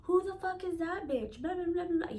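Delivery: high-pitched mock angry girlfriend; arguing. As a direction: imitate an angry accusation heard over the phone. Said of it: "I can hear her arguing".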